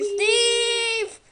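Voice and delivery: loud high call of a name; yelling. Real, not acted. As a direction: yell the name across the room. Calling out in game.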